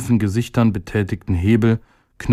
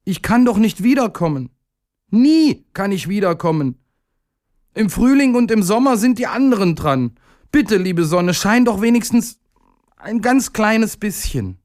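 much higher, pleading voice; little day begging; 10 semitones higher than usual. A narrator's reading. Little day begging the weak sun to shine, high pleading voice.

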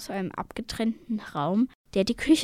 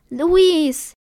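high, loud cry of the name; aghast scream; 7 semitones higher, 10 dB louder than usual. A narrator's reading. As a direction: shout out in horror for a brother. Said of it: "schrie Selena entgeistert". (entgeisterter Aufschrei)

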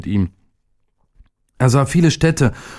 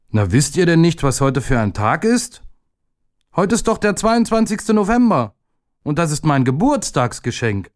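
lightly voiced proud schoolboy, raised pitch; proud boy; 6 semitones higher than usual. Boy with a new bike telling his classmates, lightly voiced proud child. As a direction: boast a little like a kid with a new bike.

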